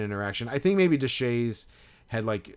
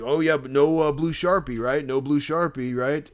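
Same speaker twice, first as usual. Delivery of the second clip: put-on sarcastic drunk; 5 semitones higher, 6 dB louder than usual. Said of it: "he's like".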